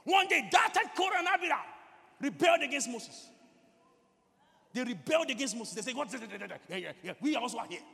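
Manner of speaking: loud, high sermon storytelling; full-force preaching. A narrator's reading. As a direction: hammer out the Bible story at full volume.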